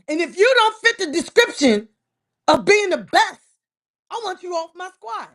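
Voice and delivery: loud, driving passion; loud declaration. Real, not acted. Eagles fan telling the roster what he demands, loud and forceful. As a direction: hammer home what the team needs.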